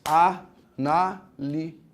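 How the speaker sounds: A-NÁ-LI-SE, very slow, syllable by syllable; separado em sílabas. Shown as a demonstration. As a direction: break "Análise" into four spaced syllables. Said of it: "como é que se separa em sílabas?". ("Análise" sílaba por sílaba, A – NÁ – LI – SE, bem devagar)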